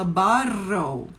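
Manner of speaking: drawn-out flapped T in "bottle"; "baw-rrol" for "bottle"; American "bottle". Shown as a demonstration. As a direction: exaggerate the R-like T in "bottle". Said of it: "é o T que faz isso".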